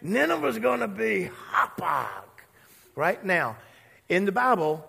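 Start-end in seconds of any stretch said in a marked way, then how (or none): 0.0-2.2 mock doom proclamation. Dramatic sermon proclamation.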